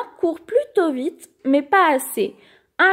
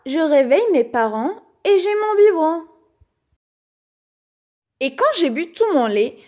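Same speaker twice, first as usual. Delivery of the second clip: plain reading voice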